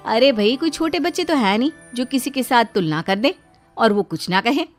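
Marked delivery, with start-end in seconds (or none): none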